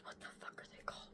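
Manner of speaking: fast hushed whisper. Real, not acted. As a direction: whisper it to yourself. Whispered aside.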